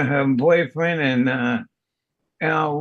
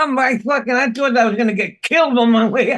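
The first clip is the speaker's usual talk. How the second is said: ordinary talk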